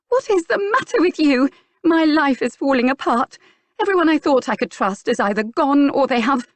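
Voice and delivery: high, loud upset cry; distraught outburst. A narrator's reading. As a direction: pour out despair and anger. Clarissa erupting, life falling apart.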